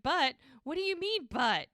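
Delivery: high, loud panicked echo of but; acting out a patient's alarm, hammed-up; alarmed disbelief. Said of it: "He thinks it's terrible".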